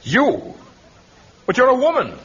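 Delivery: high, loud incredulous outburst; shocked disbelief. Acted. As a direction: blurt it out in sheer disbelief. Tarzan stunned, chief is woman.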